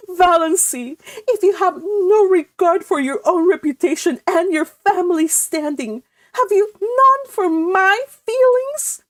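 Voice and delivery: high, loud, outraged mother; indignant mother. Acted. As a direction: demand it in a shocked high voice. Mrs Frederick voiced, indignant.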